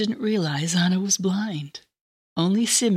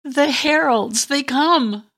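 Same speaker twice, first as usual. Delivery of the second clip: louder, slow and awed; ecstatic announcement; 7 semitones higher, 5 dB louder, much slower than usual. Acted. Anna proclaims the heralds to Simeon in slow ecstatic awe. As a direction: proclaim it like a prophecy.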